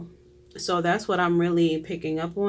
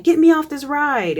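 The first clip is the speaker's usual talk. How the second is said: raised, loud acted panic; panicked plea; 9 semitones higher, 8 dB louder than usual. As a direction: plead loudly like a frightened rider. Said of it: "You panic".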